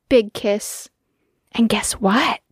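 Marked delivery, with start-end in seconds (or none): 1.5-2.4 whispered teasing secret. Acted. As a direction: lean in and whisper it to the child. Mother telling a secret.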